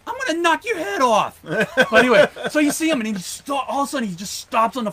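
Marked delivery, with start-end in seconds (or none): 0.1-1.4 imitating Baker. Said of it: "Baker had his Steelers dude".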